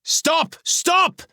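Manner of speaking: high, loud desperate cry; shouting. A narrator's reading. Elan shouts to stop the fighting, loud. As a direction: scream it to halt the fight. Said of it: "Elan shouting".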